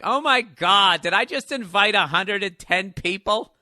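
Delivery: loud, high, horrified outburst; appalled disbelief. Retold or imitated. Host overhearing a huge invite list, loudly appalled. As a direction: blurt it out loud, stretching the disbelief.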